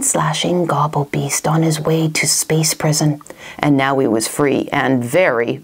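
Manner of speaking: plain reading voice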